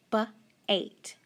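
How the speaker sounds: "b-ate" split into a short b, pause, then "ate"; b-ate in two separated teaching pieces; sounded out. Shown as a demonstration. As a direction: sound out b-ate, onset apart from the rime.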